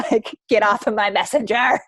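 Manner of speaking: laughing mock outburst. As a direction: toss it off with a laugh, half joking. Mock outburst at complaining coach.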